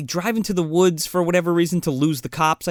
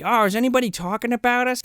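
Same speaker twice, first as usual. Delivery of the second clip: mock coy question; 8 semitones higher, faster than usual. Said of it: "Denise Richards is like".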